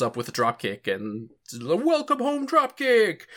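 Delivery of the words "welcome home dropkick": high, slow hammed-up war cry; mock battle cry. Ichigo's dad greeting him with a dropkick, hammed-up battle cry. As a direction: announce the flying kick like a goofy dad.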